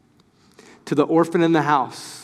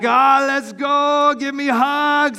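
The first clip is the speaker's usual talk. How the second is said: high, slow, drawn-out gushing greeting; high gushing welcome from a family of huggers; gushing hugger relative; 10 semitones higher, much slower than usual. Retold or imitated. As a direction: welcome everyone in with hugs. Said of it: "they're like".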